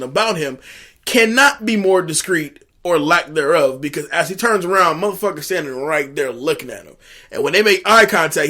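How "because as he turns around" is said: ordinary delivery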